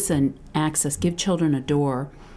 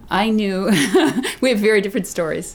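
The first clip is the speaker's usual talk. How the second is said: louder, laughing through the words; 6 semitones higher, 6 dB louder, faster than usual. Real, not acted. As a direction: blurt it out with a laugh. Laughing as she answers.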